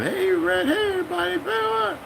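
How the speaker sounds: imitating cheerful woman. Cafeteria woman greeting the sailors, high bright call. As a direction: chirp it like a friendly cafeteria lady.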